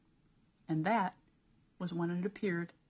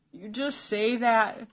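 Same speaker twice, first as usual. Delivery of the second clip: slow, louder tearful reproach; sobbing; 3 semitones higher, 7 dB louder, slower than usual. A narrator's reading. Peter, crying on his knees, answers Cole sobbing. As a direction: choke it out through sobs. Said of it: "Peter sobbed".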